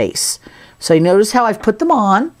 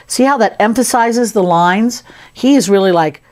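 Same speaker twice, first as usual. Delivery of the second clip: ordinary talk, nothing marked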